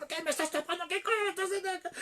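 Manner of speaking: very high, agitated mock protest; acted angry protest. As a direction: imitate a husband rejecting being hushed. Pastor pushes wife, acted quarrel. (protesta airada y muy aguda, actuada)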